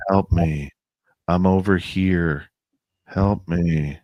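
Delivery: flat, emotionless plea; monotone voice. Retold or imitated. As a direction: say it with no emotion, dragging. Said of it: "there's no emotion".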